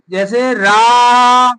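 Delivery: "रा" held long and said strongly; long loud raa. Shown as a demonstration. Teacher showing the long aa in रा, slow and strong. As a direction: stretch "रा" very slowly with force. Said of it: "जोर से बोलेंगे".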